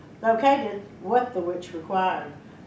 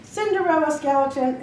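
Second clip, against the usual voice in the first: ordinary delivery, nothing marked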